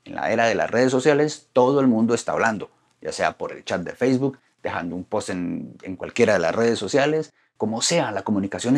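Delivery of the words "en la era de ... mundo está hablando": normal talking voice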